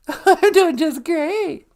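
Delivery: squeaky high puppet voice, loud; high falsetto wife voice. Acted. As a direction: falsetto Michelle puppet greeting. Puppet voice answers.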